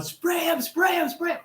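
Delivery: high, frantic yelled commands; screaming. Retold or imitated. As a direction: scream it at your friend in panic. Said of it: "I screamed".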